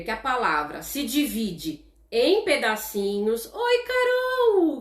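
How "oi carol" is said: high, drawn-out cheerful hello; excited greeting. Real, not acted. Teacher greeting a student who just joined the live class, excited high hello. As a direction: sing out a warm welcome to a friend.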